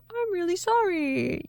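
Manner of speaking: high, put-on sheepish apology; apologetic husband. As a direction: imitate a husband pleading to be forgiven. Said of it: "and he's like".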